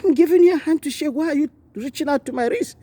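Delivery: put-on high, indignant questioning of the girl; a preacher reenacting his sharp challenge to a young girl, high and indignant; retold rebuke. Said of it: "I told her, I said".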